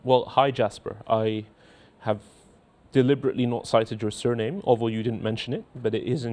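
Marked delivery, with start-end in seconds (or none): none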